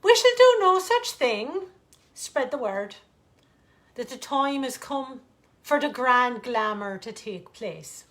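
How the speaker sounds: loud, high royal command; a queen calling her court to action; regal proclamation. Acted. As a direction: announce it grandly to the court. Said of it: "Aloud she said".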